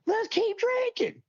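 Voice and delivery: squeaky high, slow mock voice; high silly voice. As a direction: say it in a goofy high pitch, drawn out. Retelling his airport drinking decision.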